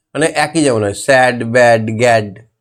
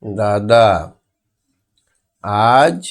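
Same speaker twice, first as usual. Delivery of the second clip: dictation; 3 semitones lower, much slower than usual. Shown as a demonstration. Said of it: "এখানে কি হবে?".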